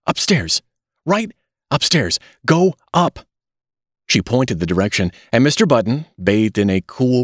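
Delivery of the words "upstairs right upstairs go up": high, panicky directions in bursts; hysterical. A narrator's reading. Terrified nurse directing Mister Button, hysterical. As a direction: send him away in a panic. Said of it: "she cried hysterically".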